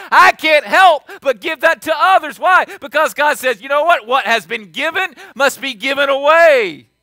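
loud, high-pitched preacher in full cry; full-force preaching. Real, not acted. Preacher telling the church why he must forgive others, full-force preaching. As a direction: drive it home to the whole church, loudly.

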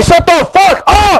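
real high-pitched, drawn-out yell; angry yell. Real, not acted. Player shutting up a teammate in a quarrel, angry yell. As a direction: screech it out slowly in fury.

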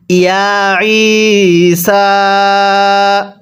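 slow, drawn-out melodic chant; Quran recitation. Shown as a demonstration. Teacher chanting the verse for students, slow melodic recitation. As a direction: stretch each vowel in a slow recitation tune.

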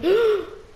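high sharp gasp of surprise; surprised gasp. Real, not acted. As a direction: catch your breath in shock. Trappola nascosta scoperta. (sussulto sorpreso)

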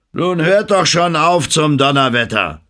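high, loud gruff snap; snapping angrily. A narrator's reading. Lumpy snapping angrily at the celebrating sailors. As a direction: bark at the crew to stop. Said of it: "fuhr er die Leute an".

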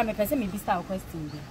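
usual speaking voice

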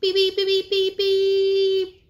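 high beeps with a long last one; car horn. Pretend. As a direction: honk like a toy car. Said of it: "My gray cars".

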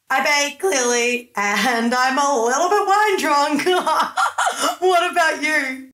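put-on high, loose, giggly wine-drunk chatter; tipsy giggling. Tipsy woman introducing herself to housemates, high and giggly. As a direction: giggle through it, very high and drawn out.

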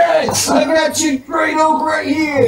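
high, slow booming taunt; shouting. Acted. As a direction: call out like a proud old oak. Player voices the tree taunting.

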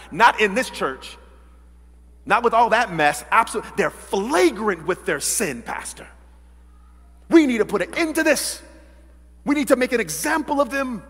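loud acted church-member outrage; indignant protest. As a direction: demand action from the pastor, voice rising.

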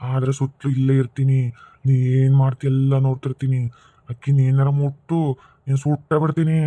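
menacing spirit voice. Acted. Jealous spirit threatens husband, low and menacing. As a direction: warn him like a jealous ghost.

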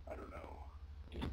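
soft breathy whisper, slowed down; whispered confidential aside; hushed aside. Real, not acted. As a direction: lean in and whisper it to the viewers.

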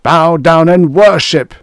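high, very loud command; screaming. A narrator's reading. Fire god demanding worship from the priest, screaming. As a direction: scream the order at unbelievers. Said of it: "Mersu screamed".

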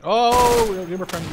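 normal talking voice

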